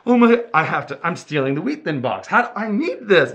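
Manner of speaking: high, loud, hammed-up excitement; retold fan frenzy. Overcome by Sandy Duncan's signed box, mock frantic fan. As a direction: gush greedily over a treasured keepsake.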